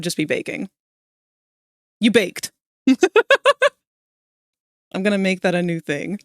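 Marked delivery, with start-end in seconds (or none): 2.9-3.7 high laugh. Real, not acted. After the baking pun, a high laugh.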